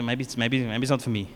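low, soft, hesitant mumble; awkward backing off. Retold or imitated. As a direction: retreat from it quietly, unsure of yourself. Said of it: "now you feel awkward".

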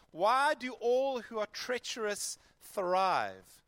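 slow, measured Bible reading; scripture reading. A narrator's reading. Preacher reading Jeremiah to the congregation, slow and measured. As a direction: read the verses with weight.

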